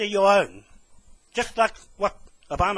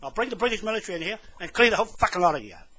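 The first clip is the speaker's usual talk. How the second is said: ordinary delivery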